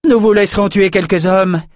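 high, boisterous yell; cocky high-pitched shout; shouted taunt. A narrator's reading. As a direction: call across the battle to an ally. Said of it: "cria Thorn à Nathan".

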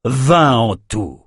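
mild, drawn-out exclamation; understated slow victory call; exclaimed. A narrator's reading. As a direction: announce the total slowly. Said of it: "s'écria Nathan".

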